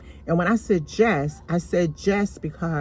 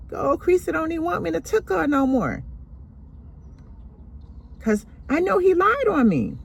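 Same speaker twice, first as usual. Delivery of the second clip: much higher, sing-song mock complaint; mock-hurt whine; 12 semitones higher than usual. Uncle complaining to grandmother, mock-hurt whine. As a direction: play the wronged victim.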